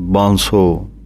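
ਬਾਂਸੋ with a nasal aa and a falling close; pronunciation demo. Shown as a demonstration. Teacher showing students how ਬਾਂਸੋ sounds, careful nasal vowel. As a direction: say ਬਾਂਸੋ slowly and clearly, nasal vowel held. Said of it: "ਬੋਲਣੀ ਹੈ ਬਾਂਸੋ".

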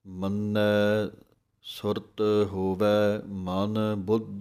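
ਬੁੱਧ with a doubled, tight ਦ; drawn-out ਮੰਨੈ, ਬੁੱਧ clipped hard; ਬੁੱਧ ਕੱਸ ਕੇ; slow recitation. Shown as a demonstration. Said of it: "ਬੁੱਧ ਦੇ ਉੱਤੇ ਥੋੜ੍ਹੀ ਜਿਹੀ ਅੱਧਕ ਲਗਾ ਕੇ ਕੱਸ ਕੇ ਬੋਲਣਾ ਹੈ".

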